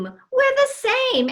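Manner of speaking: ordinary talk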